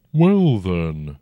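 slow, rumbling ancient dragon. A narrator's reading. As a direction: speak as a huge old dragon, unhurried. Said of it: "the dragon said".

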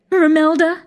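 high, sing-song call. A narrator's reading. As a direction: call her name up high. Hostess calls to her mother.

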